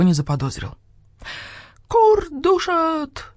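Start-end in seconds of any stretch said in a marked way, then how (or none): 1.9-3.3 high, long-drawn rooster cry. A narrator's reading. Rooster Petyunya leads the hens' chant, crying out high. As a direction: crow the slogan out slowly and high. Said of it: "прокричал в очередной раз Петюня".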